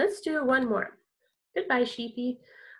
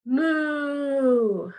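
loud shouted moo; long loud moo like a cow; 2 semitones higher, 5 dB louder than usual. Shown as a demonstration. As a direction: call out a big cow moo. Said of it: "What about this one?".

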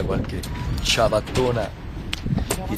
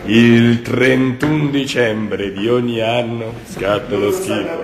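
documentary narrator; 3 semitones lower, much slower than usual. Acted. Mock documentary line.

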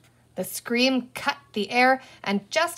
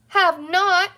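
high, loud indignant denial; outraged; 9 semitones higher, 7 dB louder than usual. A narrator's reading. Boy denies his sister's accusation, outraged. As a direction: protest like an accused little boy.

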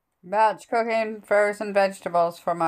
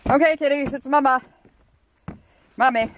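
pet talk; 7 semitones higher, 3 dB louder than usual. Real, not acted. Greeting her cats at the window.

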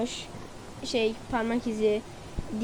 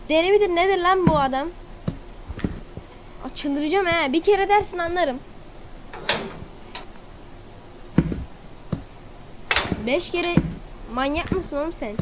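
exasperated, higher-pitched rant; bıkkın sitem; 5 semitones higher than usual. Real, not acted. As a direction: complain at the game in disbelief. Oyuna sinirlenmeye devam ediyor. (oyuna bıkkın, yüksek perdeden sitem)

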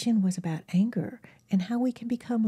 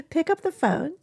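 higher laughing push to call; laughing repeat; 6 semitones higher than usual. Shown as a demonstration. Urging viewers to call friends, through a laugh. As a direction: laugh as you urge them again. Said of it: "There you go".

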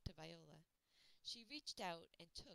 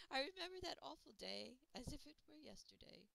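put-on grieving old woman; 8 semitones higher, 9 dB louder than usual. Old woman recalls tragedy.

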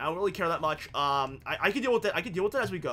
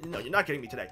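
ordinary delivery

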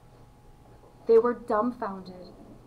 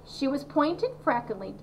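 ordinary delivery, nothing marked

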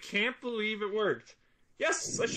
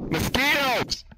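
outraged cry; 4 semitones higher, 4 dB louder, slower than usual. Acted. Exclaiming mosquitoes in outrage.

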